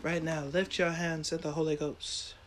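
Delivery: hushed, low prayerful preaching; soft worship call. Acted. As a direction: bring it down soft and reverent. Quiet worship call after climax.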